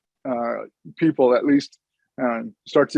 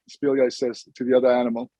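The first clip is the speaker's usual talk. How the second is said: ordinary delivery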